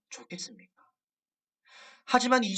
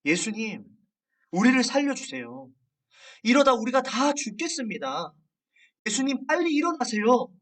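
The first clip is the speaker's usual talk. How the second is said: higher-pitched, mildly urgent plea for help; frightened cry retold; 6 semitones higher, 4 dB louder than usual. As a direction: voice the frightened disciples with restrained urgency. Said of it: "겁에 질린 채 ... 깨우면서 소리칩니다".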